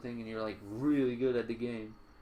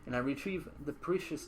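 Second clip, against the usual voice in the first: usual speaking voice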